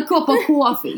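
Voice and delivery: "A cup of coffee" with a broad New York "caw-fee"; New York accent. Pretend. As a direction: order "A cup of coffee" like a Brooklyn local. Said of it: "do, do that again".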